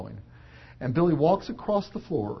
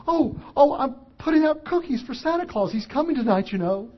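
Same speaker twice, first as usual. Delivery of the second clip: put-on high, eager little boy; lightly voiced small child; 9 semitones higher than usual. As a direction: chirp it like a four-year-old explaining Santa. Four-year-old Billy voiced.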